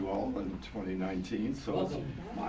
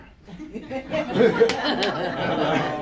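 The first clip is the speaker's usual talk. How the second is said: loud burst of laughter; hearty laugh; 11 semitones higher, 13 dB louder than usual. Real, not acted. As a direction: laugh out big at the joke. Laughs at own joke.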